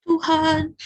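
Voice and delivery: high, loud acted wail; weeping prayer. Hana pouring out her heart to God, acted weeping cry. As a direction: call out through put-on sobs. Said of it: "Hana berdoa sambil menangis".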